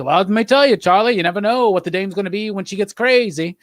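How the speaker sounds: hammed-up old Hollywood American accent; forties American film accent. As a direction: talk like a forties American movie tough guy. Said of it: "formulated accent for Hollywood".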